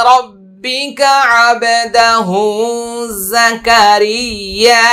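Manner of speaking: high, slow melodic verse recitation; tajweed recitation of the verse, repeated; Quran recitation. Acted. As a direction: chant the verse reverently, stretching vowels. Recites the verse after spelling.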